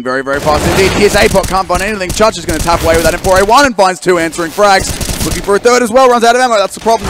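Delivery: high, loud esports shoutcast; hyped caster in full flow; excited play-by-play. Real, not acted. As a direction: build to a peak as the fight unfolds.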